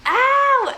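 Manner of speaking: high drawn-out "ow!"; retold cry of pain. Labouring mom as the epidural goes in, retold yelp. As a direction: yelp it in pain, mimicking the moment. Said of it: "I was screaming, I was all of it".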